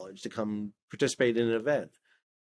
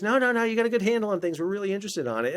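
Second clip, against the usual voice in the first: much higher, fast and eager voice; voicing the official; 9 semitones higher, faster than usual. Government official reassuring Sal, eager and high. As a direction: play the caller brushing off his modesty.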